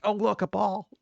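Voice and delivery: a hint of a cheerful dog voice; lightly voiced happy pet. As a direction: chirp it out like an excited pet. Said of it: "He'd just be like".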